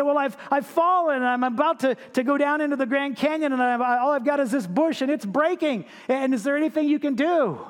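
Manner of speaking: high, pleading, stammering; desperate plea. Acted. Man clinging to a bush begging the voice above, high and frantic. Hanging man explains his peril.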